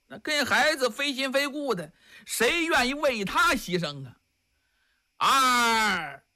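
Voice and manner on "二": menacing count. Acted. Monk counting before execution.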